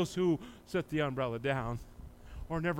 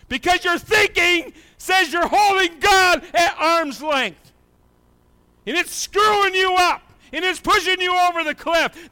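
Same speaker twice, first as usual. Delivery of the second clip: high, loud preaching shout; preaching at full force; 11 semitones higher, 10 dB louder than usual. Acted. Preacher warning Christians who keep God at arm's length, shouting. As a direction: hammer it home to the church.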